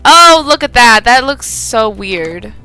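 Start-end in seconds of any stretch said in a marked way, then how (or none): none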